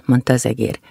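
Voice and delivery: usual speaking voice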